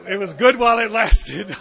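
loud laugh breaking through the words; fond, loud laughing memory. Real, not acted. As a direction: burst out laughing as you say it. Laughing about smoking in meetings.

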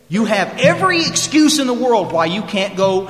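retold scolding, high and loud; dander up. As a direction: act out a sponsor tearing into excuses. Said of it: "I can see his dander getting up".

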